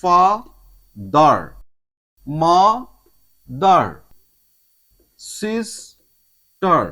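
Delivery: "Father, mother, sister" each broken in two, drawn out; very slow two-beat "ফা-দার, মা-দার, সিস-টার"; split into syllables. Shown as a demonstration. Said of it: "ওয়ার্ডকে ভাঙলে".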